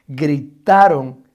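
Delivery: drawn-out loud cry of gritaron; a preacher acting out the shout; mock scream. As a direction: stretch gritaron out slow and loud. Preacher dramatizes disciples screaming.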